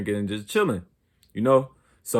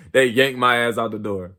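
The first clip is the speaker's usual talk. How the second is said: ordinary talk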